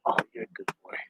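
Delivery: softly whispered praise for the dog. Real, not acted. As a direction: breathe it to the dog close by.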